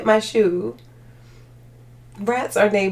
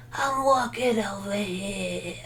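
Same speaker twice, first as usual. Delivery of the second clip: mob voice; 6 dB quieter, slower than usual. Pretend. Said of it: "My mob voice".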